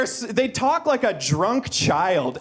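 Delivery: ordinary talk, nothing marked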